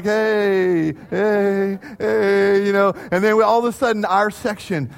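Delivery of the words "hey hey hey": half-hearted little heys; pitying cheer. Retold or imitated. Moms taking pity on junior highers, pitying cheer. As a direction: humour them with a token cheer. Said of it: "moms had pity on the junior hires".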